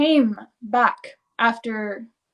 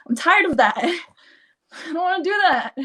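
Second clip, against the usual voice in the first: laughing mock complaint; 7 semitones higher, faster than usual. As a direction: giggle out a fed-up protest. Past-life self voiced, laughing.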